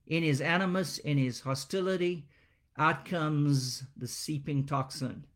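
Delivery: ordinary talk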